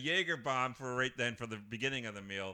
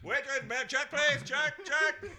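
higher, louder, hurried calls for the check; louder and higher with every call for the check; frantic rush; 9 semitones higher, 8 dB louder than usual. Retold or imitated. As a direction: push to leave, rising to a laughing shout. Rushing to end the date.

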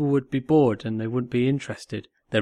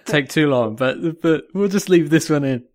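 laughing; 2 semitones higher, 6 dB louder than usual. Real, not acted. Laughing about Joey.

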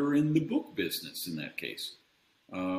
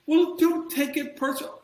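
very high, louder sing-song parody; mock advice-giver; 7 dB louder than usual. Mocking glib advice from sales people, very high parody voice. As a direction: ham up a glib know-it-all. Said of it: "and he's".

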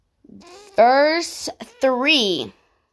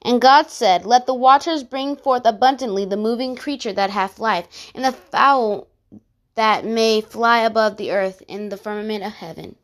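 booming biblical narration; 3 semitones lower, much faster than usual. Acted. Reads Genesis verses aloud.

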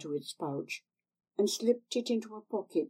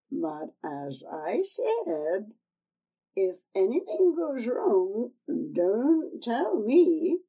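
slow, higher, louder hag's warning; old crone, slow and ominous; 4 semitones higher, 5 dB louder, slower than usual. Acted. Old woman warns Tanner.

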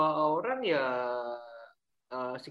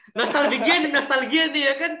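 laughing; 8 semitones higher, 5 dB louder than usual. Real, not acted. Teasing about his school fame.

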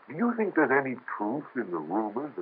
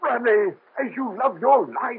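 high, urgent, frightened plea; frantic plea; 12 semitones higher, slower than usual. Acted. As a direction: beg him to stop for his life. Frantic plea to stop Rene.

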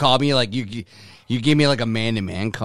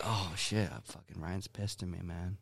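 imitating a nervous friend under his breath; soft, sheepish muttered aside; mock-worried buddy murmur; hushed worried mock quote; 6 semitones lower, 16 dB quieter than usual. Quoting friend afraid he's angry.

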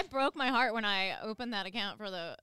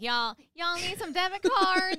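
a slow, much higher and louder Southern drawl; 10 semitones higher, 10 dB louder, slower than usual. Retold or imitated. Bank teller copying a customer's Southern accent, slow and loud. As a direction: stretch out "Y'all" like a thick Southern customer. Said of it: "harsh Southern drawl ... talk like them".

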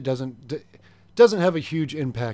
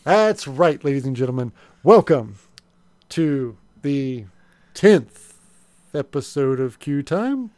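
loud, showy show host intro; game show host; 4 dB louder, slower than usual. Acted. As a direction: welcome the audience like a TV host. Hosts mock gadget show.

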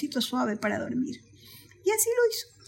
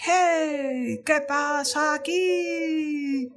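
slow, stretched-out lion call; much slower than usual. Acted. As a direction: call across the jungle, drawing out words. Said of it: "Y empezó a preguntar". (rey león llamando, palabras muy alargadas)

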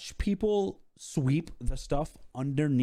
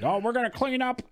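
higher-pitched mock protest; mock complaint; 10 semitones higher than usual. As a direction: imitate someone balking at a chore. Mimics balking at cleanup duty.

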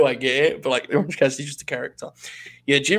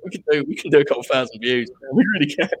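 ordinary talk, nothing marked

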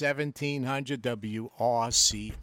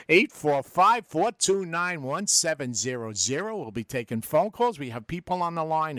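ordinary delivery

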